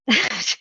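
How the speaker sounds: a brief, friendly laugh; kind gentle laugh. Real, not acted. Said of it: "I'm not laughing at you".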